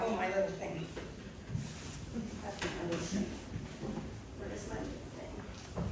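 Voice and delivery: quiet, distracted searching aloud; muttering to oneself. Real, not acted. Speaker hunts for a misplaced remote, muttering to herself. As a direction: trail into soft self-talk while hunting for something.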